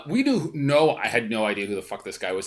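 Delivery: usual speaking voice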